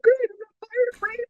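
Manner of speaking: high, breathless relieved babble. Retold or imitated. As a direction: gush with relief at not being fired. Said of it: "And I'm like".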